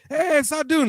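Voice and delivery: teasing call. Retold or imitated. Imitating coworkers calling out.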